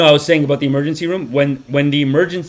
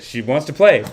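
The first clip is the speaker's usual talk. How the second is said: ordinary talk, nothing marked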